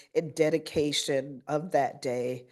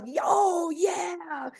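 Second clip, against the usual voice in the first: put-on much higher, kid-like cheer; little kids yelling; 12 semitones higher than usual. Principal imitating her little students answering her, high kid cheer. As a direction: imitate small children shouting back. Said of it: "And the little ones would yell".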